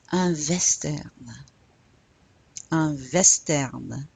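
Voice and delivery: WESTERN said "vess-TAIRN", French style; French "western", the W turned into V. Shown as a demonstration. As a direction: start "western" with a V sound. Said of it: "pronounce the W like a V".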